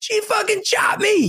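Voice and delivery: put-on high, drawn-out kid's wail without full volume; mock screaming. Kid telling the teacher she chopped him, mock scream. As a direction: imitate a screaming kid tattling. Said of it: "He was screaming".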